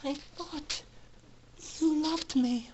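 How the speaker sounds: crushed filly quietly faces her betrayer; broken whisper. Acted. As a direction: say it barely above a breath, heartbroken. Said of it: "looked up slowly ... deep into".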